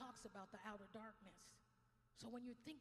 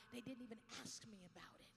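normal talking voice

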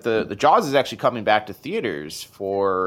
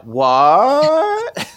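loud, high, long rising yell; hammed-up sassy shout; 13 semitones higher, 8 dB louder than usual. Fan defiantly quoting Jaws at annoyed audience, hammed-up shout. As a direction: stretch it upward in huge mock disbelief.

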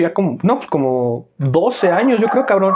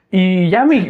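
usual speaking voice

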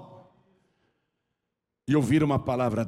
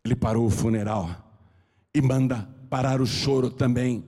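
ordinary delivery

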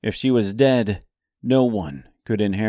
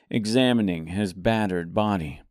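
usual speaking voice

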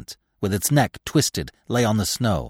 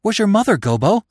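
high, loud anxious question; urgent, impatient; 11 semitones higher, 6 dB louder than usual. A narrator's reading. Bambi excitedly questions fallen Gobo, urgent. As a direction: demand it urgently, voice raised. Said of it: "gasping for breath".

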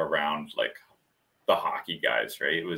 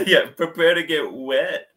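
snooty giraffe voice; 9 semitones higher, 6 dB louder than usual. Acted. Giraffe voice continues.

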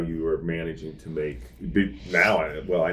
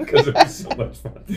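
laughing; 4 dB louder than usual. Real, not acted. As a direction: laugh it out, a bit worn. After the rap battle.

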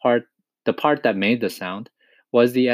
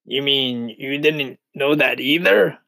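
slow, mildly mocking question; drawn-out storybook scorn; scornful centipede; 3 semitones higher than usual. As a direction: sneer lightly at someone's ignorance. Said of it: "the centipede said scornfully".

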